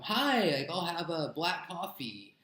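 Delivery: high, slow, loud tourist ordering in English; mock clueless tourist. Tourist loudly ordering coffee in English at McDonald's, mocking. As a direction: play the oblivious foreigner barking an order. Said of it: "the proper thing to do is to not be like".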